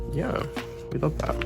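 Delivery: usual speaking voice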